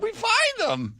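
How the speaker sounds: very high put-on English accent. Host mimics the English airline agent, very high English accent. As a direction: chirp it in a mock English accent. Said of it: "the lady with the English accent said".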